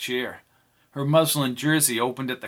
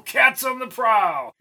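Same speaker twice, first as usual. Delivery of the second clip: high, slow shouted cackle; hyena shout; 12 semitones higher, 4 dB louder than usual. Acted. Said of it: "said Albie with a hyena laugh".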